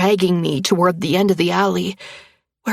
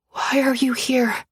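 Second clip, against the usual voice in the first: frightened whisper; 6 semitones higher than usual. A narrator's reading. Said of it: "I whisper".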